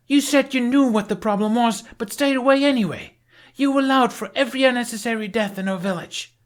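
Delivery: furious blame. A narrator's reading. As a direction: lay every death at his feet. Astrid's final accusation.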